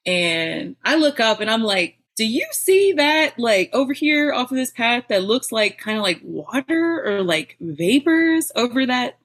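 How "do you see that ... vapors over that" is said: high, eager question; higher, louder excited question to a friend; excited discovery. Retold or imitated. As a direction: point it out with wonder. Excitedly pointing out vapor to friend.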